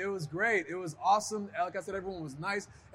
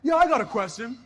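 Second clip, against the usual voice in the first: teasing question; 9 semitones higher, 6 dB louder than usual. Retold or imitated. Teammate heckles in retold scene.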